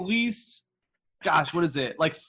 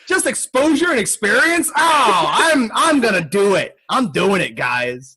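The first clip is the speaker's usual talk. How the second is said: loud mock excitement about working for exposure; sarcastic enthusiasm; 4 dB louder than usual. Mocking an unpaid gig offer, loud sarcastic cheer. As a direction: ham up the sarcastic eagerness. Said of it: "I literally was like".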